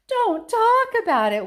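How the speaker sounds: high, loud, slow wail. A narrator's reading. Mother wails at her husband, distressed. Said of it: "wailed Missus T V".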